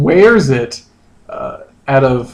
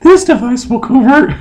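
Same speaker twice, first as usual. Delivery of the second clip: much higher, lightly put-on girl voice; Jenna voice; 9 semitones higher than usual. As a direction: pitch it up like a cartoon girl. Reading Jenna's part.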